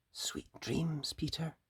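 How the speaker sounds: very soft, tender goodnight; soft whisper. Acted. Missus Rabbit saying goodnight to sleeping Peter, softly. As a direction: breathe it gently as he falls asleep. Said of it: "whispered Missus Rabbit".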